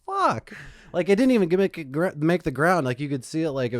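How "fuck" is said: shocked outburst. Retold or imitated. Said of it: "I was, like, kinda loud".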